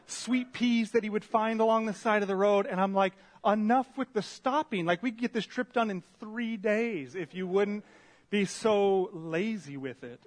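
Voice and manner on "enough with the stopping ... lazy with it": loud, higher-pitched mock complaint; fed-up griping, played up for the audience; retold exasperation. Rider fed up with a partner's constant stopping, exasperated.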